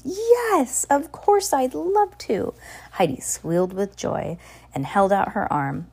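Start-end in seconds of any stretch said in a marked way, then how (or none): 0.0-2.5 much higher, slow and delighted; a girl thrilled to be asked; beaming. A narrator's reading. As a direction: beam with joy as you say it. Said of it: "Sunny beamed".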